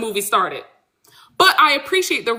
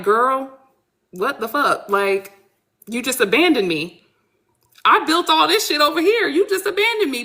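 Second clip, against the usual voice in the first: acted-out indignation, pitch climbing; mildly fed-up, rising into high protest; irritated; 4 semitones higher than usual. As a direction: complain like someone just got abandoned. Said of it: "Desiree is a little, like, irritated".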